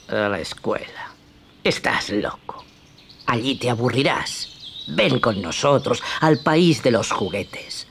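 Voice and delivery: a hushed, crafty greeting; sly fox. Acted. Fox tempts Pinocchio.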